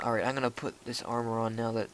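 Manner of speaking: ordinary talk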